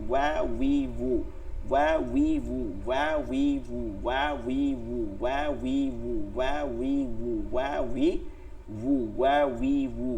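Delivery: "ওয়া উই উ" with both lips rounded into a w; rounded wāw drill. Shown as a demonstration. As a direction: chant "ওয়া উই উ" in an even, repeating drill. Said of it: "ওয়াও হচ্ছে দুই ঠোঁট গোল করে". (দুই ঠোঁট গোল করে "ওয়া উই উ")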